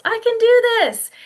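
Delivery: loud, high pep talk to yourself; bright rallying affirmation; positive self-talk. Acted. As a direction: cheer yourself on, pitched right up. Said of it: "we say positive things to ourselves".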